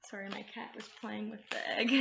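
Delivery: usual speaking voice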